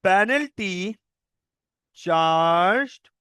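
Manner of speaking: word-by-word definition read slowly with long gaps; teacher reading a definition aloud for notes; slow dictation. Shown as a demonstration. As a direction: dictate so the class can write every word.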